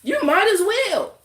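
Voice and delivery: high, loud sarcastic jab at viewers; sarcastic high taunt at the nosy viewers; mocking taunt. Real, not acted. Mocking watchers on live stream.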